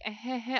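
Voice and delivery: high, soft awkward "heh heh"; awkward chuckle. Retold or imitated. Friend imitating an awkward side-hug reaction, awkward chuckle. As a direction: mimic an uneasy little laugh. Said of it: "you're, like, awkward".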